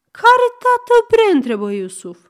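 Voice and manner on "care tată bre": baffled shout. Acted. Iusuf asks, baffled.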